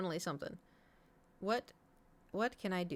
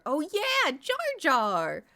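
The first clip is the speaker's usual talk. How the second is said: excited outburst; 9 semitones higher, 7 dB louder, much slower than usual. Real, not acted. Excited at Jar Jar.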